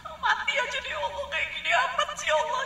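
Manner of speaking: high, whiny complaining lament; lamenting Maryam. Retold or imitated. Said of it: "nyesel-nyesel diomongin sama dia". (Maryam mengeluh manja dengan suara tinggi)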